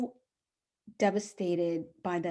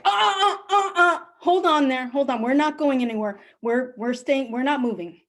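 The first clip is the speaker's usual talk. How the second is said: high stammering mock panic, louder; mock panicked husband; 5 semitones higher, 5 dB louder than usual. Husband refusing to move, hammed-up panic. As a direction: imitate a flustered husband blurting out.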